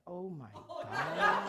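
very slow, high, laughing; laughing disbelief. Retold or imitated. Hearing the roommate thought she was a prophet, laughing. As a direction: drag it out in amused shock.

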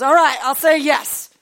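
loud, high grudging yes; husband giving in. Retold or imitated. Husband caving in to his wife, loud and high. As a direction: give in loudly and reluctantly. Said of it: "He goes".

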